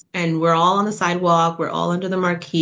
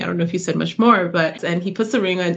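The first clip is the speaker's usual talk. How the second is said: usual speaking voice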